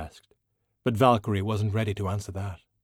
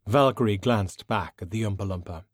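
plain reading voice

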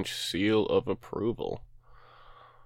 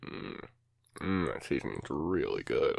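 licking fingers; 6 semitones lower, 5 dB quieter than usual. Real, not acted. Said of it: "I'm gonna lick my fingers".

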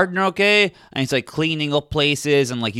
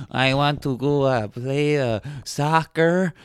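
put-on hesitant foreign-accented English, slowing down; Santiago's accent; slower than usual. Newcomer asks locals to play, hesitant foreign accent.

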